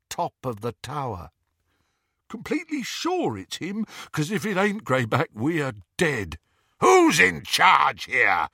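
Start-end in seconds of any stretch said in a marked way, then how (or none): 2.3-6.4 higher, quick worried henchman; nervous snatcher. A narrator's reading. Snatcher warning Greyback, higher and nervous. 6.8-8.5 louder, slow werewolf roar. A narrator's reading. Greyback to the snatchers, slow loud roar. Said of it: "roared Greyback".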